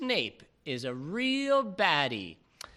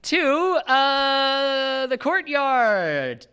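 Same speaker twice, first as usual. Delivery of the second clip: loud, higher, slow announcer call; grand announcement; 8 semitones higher, 7 dB louder, much slower than usual. Acted. Game master grandly announces the courtyard scene. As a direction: proclaim the new scene.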